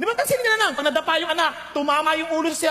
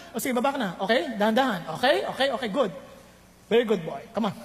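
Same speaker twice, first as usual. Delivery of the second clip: calm coaching parent; 4 semitones lower than usual. Retold or imitated. Parent calmly guiding a child.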